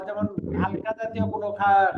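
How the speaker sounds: normal talking voice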